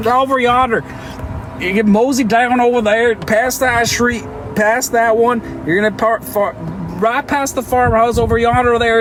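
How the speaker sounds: put-on Southern accent. Streamer showing how to use yonder in a hammed-up Southern accent. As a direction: play a cheerful cowboy giving directions. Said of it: "How would you use that in, like, a sentence?".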